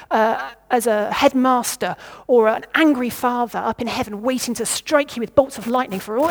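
ordinary delivery

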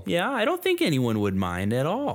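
put-on playful Midwestern accent. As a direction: reassure like a Fargo local. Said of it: "talk like we're from the Midwest there".

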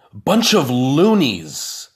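grumbling in disgust. A narrator's reading. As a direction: spit out the words in disgust. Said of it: "he was saying over and over".

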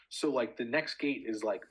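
ordinary talk

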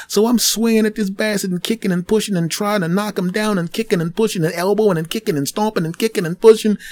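ordinary talk, nothing marked

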